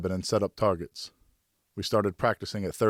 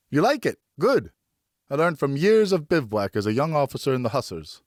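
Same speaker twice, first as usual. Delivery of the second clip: pleased father; 6 semitones higher, 4 dB louder than usual. Acted.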